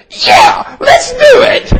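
very high, eager imitation of a wife; gleeful agreement. Wife grins and agrees to give the money, gleeful imitation.

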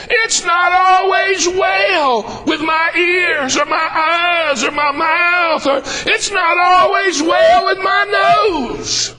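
high, slow shouted refrain with a cry; full-force preaching. Acted. Preacher to congregation, slow shouted crying refrain. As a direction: draw each word out like a climax.

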